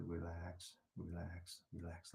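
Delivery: quiet, low relax repeated; soft whisper. Shown as a demonstration. Teacher telling students to whisper relax, softly. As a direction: hush it to yourself. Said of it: "Another word for that is whispering".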